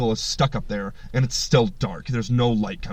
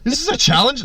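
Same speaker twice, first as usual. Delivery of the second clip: high mock challenge; 10 semitones higher, 4 dB louder than usual.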